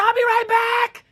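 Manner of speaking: shouting back to mom at midnight; shouted reply. Retold or imitated. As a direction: yell it while running out. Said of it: "I said".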